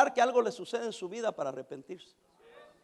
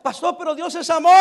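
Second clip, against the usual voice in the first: high, loud mock protest; mimicked objection; 6 semitones higher, 8 dB louder than usual. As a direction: protest as an indignant objector, pitched up. Voices an objector protesting to pastor. (imitando una protesta en voz alta)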